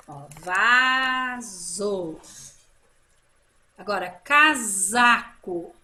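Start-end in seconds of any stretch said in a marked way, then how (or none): 0.4-2.2 "vaso" loud and very slow with a buzzing Z. Shown as a demonstration. Teacher showing pupils the sound, Z in "vaso". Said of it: "o som do Z". 4.1-5.7 "casaco" loud and deliberate with a buzzed Z. Shown as a demonstration. Teacher giving the next example, Z in "casaco". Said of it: "Também foi o som".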